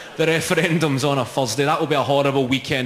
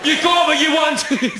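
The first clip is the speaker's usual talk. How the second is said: high-pitched, furious shout with words dragged out; angry drunken yelling; 13 semitones higher, slower than usual. Acted. Drunk man yells at a woman after losing the vote, angry shouting. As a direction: yell it across the street, voice pushed right up.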